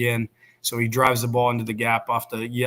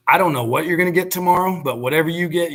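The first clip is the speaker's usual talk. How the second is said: higher and louder, revved-up pep talk; excited encouragement; 6 semitones higher, 5 dB louder, faster than usual. Retold or imitated. Father on the phone hypes up his son before the game, higher and louder. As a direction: fire him up like a dad hyping his kid.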